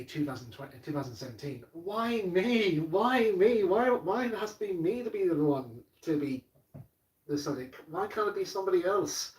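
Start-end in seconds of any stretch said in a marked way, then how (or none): 1.7-9.3 high, loud cry of why me; loud, pleading despair, much higher than his own voice; anguished outcry. Retold or imitated. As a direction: wail it out like it's too much to bear. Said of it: "to quote what I said to Andrew Brenner".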